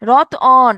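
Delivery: রতন with an emphatic long vowel between ত and ন; inserted vowel. Shown as a demonstration. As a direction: draw out the second vowel of রতন. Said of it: "এখানে একটা অধ্বনি আসছে না".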